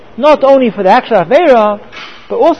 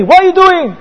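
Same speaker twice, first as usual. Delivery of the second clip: higher-pitched mock yell, imitating an outraged student; retold angry scream; 8 semitones higher, 4 dB louder than usual. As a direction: yell it indignantly, as the man who screamed. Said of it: "he screamed at me".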